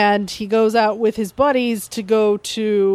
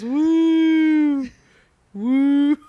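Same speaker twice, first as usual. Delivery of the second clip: higher, airy hoots fading; thin high woo; 5 semitones higher than usual. Retold or imitated. Dead Bender rolled out, air leaking, higher woo. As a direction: let the woos leak out like air escaping.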